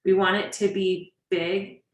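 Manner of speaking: ordinary talk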